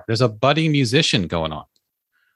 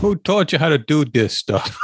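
imitated PSA father, higher; commercial dad; 4 semitones higher than usual. Echoing the dad just heard in the commercial, mock-indignant. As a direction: copy the dad's indignant demand. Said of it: "I was probably spot on".

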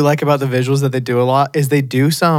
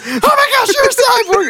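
high, squealing mock panic; freaking out. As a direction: squeal it like she's losing it. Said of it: "She just freaks out".

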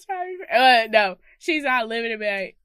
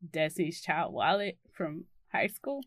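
ordinary talk, nothing marked

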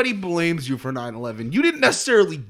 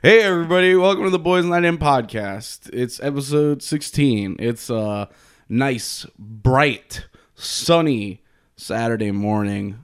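podcast host intro; 5 semitones lower than usual. Acted. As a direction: show host greeting.

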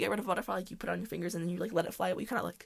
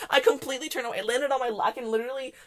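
usual speaking voice

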